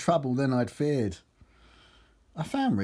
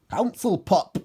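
high, loud exclamation; Geordie character; 7 semitones higher, 5 dB louder than usual. A narrator's reading. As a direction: announce it in a raised Geordie voice. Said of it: "said Geordie Steve".

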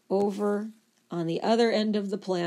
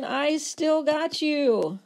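screaming liver; 6 semitones higher than usual. Acted. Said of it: "the liver's still screaming".

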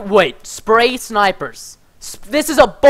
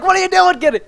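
high mock-alarmed question; retold alarm; 8 semitones higher than usual. Telling Kenny how he reacted a moment ago, retold alarm. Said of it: "I was like".

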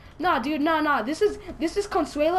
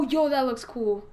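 usual speaking voice

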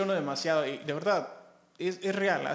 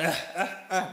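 risa nerviosa. Real, not acted. Laughing at own excitement.

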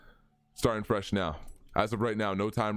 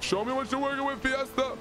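excited; 10 semitones higher than usual. Real, not acted. Race start hype.

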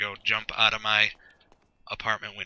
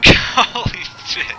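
shocked; 9 semitones higher, 12 dB louder, slower than usual. Real, not acted. Sudden crash in the game.

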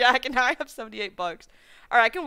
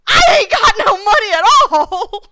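loud, higher mock-crying wail; crying-laughing shout of fake poverty; 9 semitones higher, 14 dB louder than usual. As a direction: ham up despair at being broke. Mock despair at being broke.

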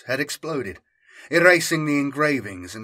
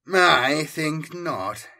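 slow, cold menace; 4 semitones higher, 3 dB louder, much slower than usual. A narrator's reading. Chaos lord to the traitor, slow and cold. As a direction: savour each word, unhurried and cruel. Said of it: "he said".

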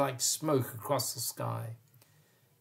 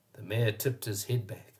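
usual speaking voice

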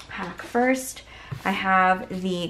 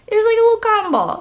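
excited squeal; 8 dB louder than usual. Real, not acted. Delighted by tiny cotton ball.

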